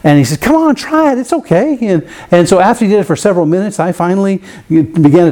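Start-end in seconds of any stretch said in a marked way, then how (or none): 0.4-1.8 higher, lightly voiced trainer egging him on; coaxing trainer. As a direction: coax like a relaxed trainer. Trainer coaxing him to touch whale.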